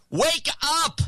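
very high wake-up call; sudden high-pitched holler; attention-grabbing hook. Shown as a demonstration. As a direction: cry it out as an opening hook. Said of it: "Just start off with".

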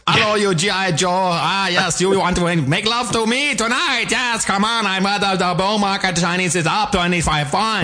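squeaky broken-English radio voice; mock Chinese accent. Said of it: "This is like Tokyo Rose".

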